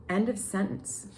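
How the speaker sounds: normal talking voice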